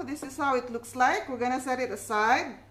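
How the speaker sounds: usual speaking voice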